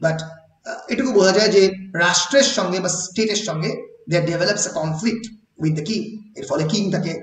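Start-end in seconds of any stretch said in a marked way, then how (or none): none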